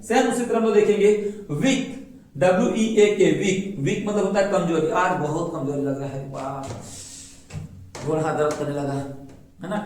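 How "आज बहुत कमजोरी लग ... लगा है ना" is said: a touch of tired, feeble complaining; mock weakness. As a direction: groan it out like someone feeling weak. Acting out feeling weak.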